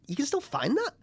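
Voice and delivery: squeaky high incredulous voice; mock-amazed high pitch; high mock surprise. Mimicking parents' surprise.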